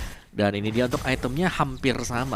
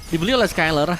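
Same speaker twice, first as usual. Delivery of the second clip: excited; 10 semitones higher, 7 dB louder than usual. Real, not acted. Cohost reacting to item.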